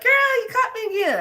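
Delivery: playful high voice of a caught friend; imitating Deb. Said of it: "She'd be like".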